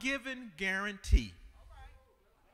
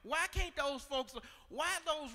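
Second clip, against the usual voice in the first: high, loud, crying out to God; preaching at full force; 7 semitones higher, 4 dB louder than usual. Acted. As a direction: whoop the congregation up.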